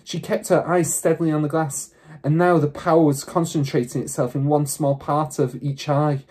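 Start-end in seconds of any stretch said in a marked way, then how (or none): none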